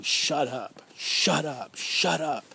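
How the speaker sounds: repeated hushing command rising in pitch; a hint of an abuser hissing shut up; menacing voice. Survivor recalling the voice that silenced them, mildly menacing. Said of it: "That voice saying".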